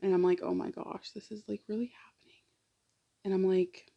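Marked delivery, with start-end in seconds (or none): 0.4-2.4 whispered to herself in disbelief; hushed disbelief under her breath; stunned whisper. Retold or imitated. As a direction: breathe it out, barely voiced, as if it's just sinking in. Stunned self-talk in the waiting room.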